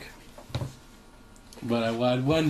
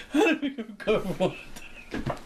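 laughing through it; 7 semitones higher, faster than usual. Retold or imitated. Acted quarrel, laughing.

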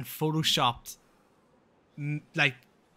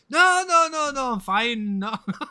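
loud, high-pitched string of protests with a laugh; panicked refusal; 11 semitones higher, 8 dB louder than usual. Retold or imitated. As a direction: hurriedly fend it off, half laughing. Retold panicked refusal of proposition.

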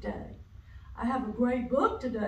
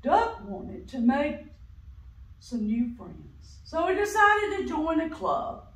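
ordinary delivery

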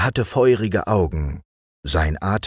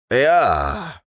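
put-on old hermit voice; 6 semitones higher than usual. A narrator's reading. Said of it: "sagte der Alte".

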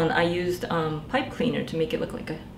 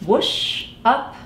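whooshing sound effect; 5 semitones higher, 6 dB louder than usual. Acted. Sound of flying rocket.

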